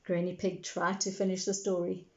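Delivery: ordinary delivery, nothing marked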